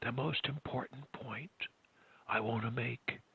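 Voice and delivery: very soft, hushed voice; near whisper. Shown as a demonstration. Coach modelling a speech to professors, near whisper. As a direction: drop it almost to a whisper. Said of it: "even a whisper on occasion".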